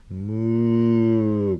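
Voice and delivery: long drawn-out moo; mooing cow. Acted. Cow blocking Thomas's track, long moo. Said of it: "goes the cow".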